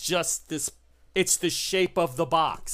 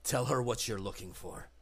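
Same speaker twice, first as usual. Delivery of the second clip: a hint of cold command, low and soft; mildly menacing instruction; quiet order; 5 semitones lower, 7 dB quieter than usual. Arturo orders John to question her, low and cold. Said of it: "he says".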